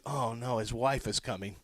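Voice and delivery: low, soft dismayed aside; mock dread. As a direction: drop down into a quiet "oh, no". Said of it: "It made you nervous and scared".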